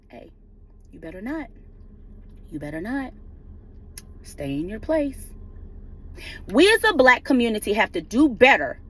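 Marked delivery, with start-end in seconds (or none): 0.1-1.5 low, hushed mock threat; a quiet, ominous voice for the system warning prosecutors; menacing warning. Prosecutors being told by the system to back off, low and menacing. 2.5-5.3 menacing warning. Retold or imitated. The system telling prosecutors to stay in line, low and hushed.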